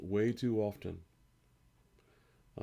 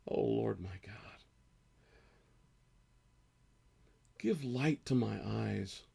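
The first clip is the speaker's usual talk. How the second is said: slow emotional scripture reading; 4 dB louder than usual. Acted. Pastor reading Psalm thirteen aloud to his church, slow and pleading. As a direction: read it like a lament, aching and crying out to God. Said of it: "I'm gonna read this slowly".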